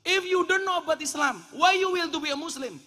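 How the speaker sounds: high, loud mock interrogation; incredulous challenge. Challenges the convert's answer. (menantang heran dengan suara tinggi dan keras)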